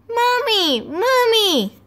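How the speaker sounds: high, loud chick cries; peeping baby chick. Acted. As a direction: squeal like a newborn chick. Chicks calling.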